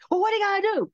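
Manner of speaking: high, incredulous question; surprised. Retold or imitated. Boss retold, high.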